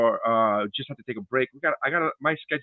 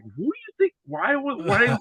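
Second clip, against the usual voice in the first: mock incredulous Conan; 10 semitones higher than usual. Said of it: "He's like".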